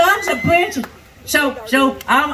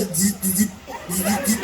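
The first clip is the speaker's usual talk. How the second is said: buzzing vibrator noise; 7 semitones lower, 8 dB quieter than usual. Retold or imitated. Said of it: "it goes".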